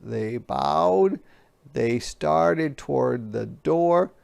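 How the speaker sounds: ordinary delivery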